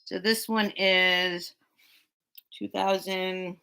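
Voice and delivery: talking with a gummy in mouth; drooling. Real, not acted. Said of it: "I'm kinda drooling with my gummy in my mouth".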